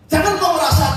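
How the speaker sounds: loud, high preacher's warning; shouted warning. Real, not acted. Pastor shouts a warning at the congregation, loud. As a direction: call it out over the music.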